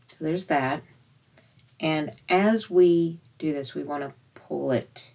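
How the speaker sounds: normal talking voice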